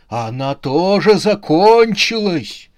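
loud, high, drawn-out roar; frustrated growl, pushed up and loud. A narrator's reading. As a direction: roar it in slow exasperation. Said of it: "прорычал потлатый человек".

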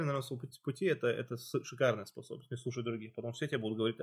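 ordinary delivery

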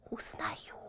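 soft breathy whisper. Acted. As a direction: whisper back secretly. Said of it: "тоже шёпотом ответила Метель".

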